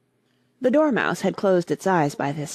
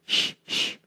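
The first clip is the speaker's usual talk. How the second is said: shushing. A narrator's reading. Hatter and March Hare silencing Alice, shushing. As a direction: hush her down. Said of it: "the Hatter and the March Hare went".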